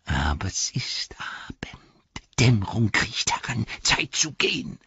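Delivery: slow unvoiced hissing whisper; Gollum whisper. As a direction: wheedle it in a sly whisper. Gollum voice continues.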